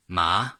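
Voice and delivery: ma rising upward; second tone ma. Shown as a demonstration. Teacher demonstrating Mandarin second tone on ma.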